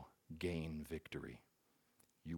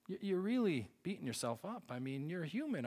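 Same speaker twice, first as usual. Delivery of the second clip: put-on older brother consoling; 11 semitones higher than usual. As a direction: imitate a brother gently letting him off. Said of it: "and he said to me".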